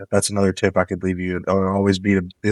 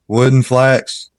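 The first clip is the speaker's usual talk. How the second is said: wood and flax said slowly, higher and louder; slow loud emphasis; 4 semitones higher, 6 dB louder, much slower than usual. Real, not acted. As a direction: hammer home wood and flax as the key tip. Repeating the tip for emphasis.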